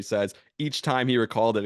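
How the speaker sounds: ordinary delivery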